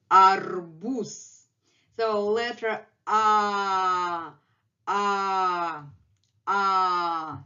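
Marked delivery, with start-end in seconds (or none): 2.0-7.4 A, A slow with mouth wide open. Shown as a demonstration. Teacher showing a wide-open A to students, slowly. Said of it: "opened his mouth very wide".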